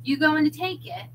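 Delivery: pointed, impatient question. A narrator's reading. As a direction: push the customer for an answer. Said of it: "more than a hint of impatience".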